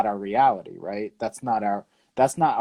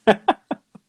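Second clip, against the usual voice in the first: short laugh; 11 semitones higher, 4 dB louder than usual. Real, not acted. Other speaker laughs.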